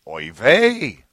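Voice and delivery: lightly put-on Yiddish-accented exclamation.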